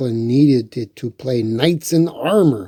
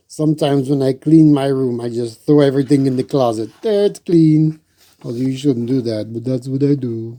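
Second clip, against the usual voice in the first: ordinary delivery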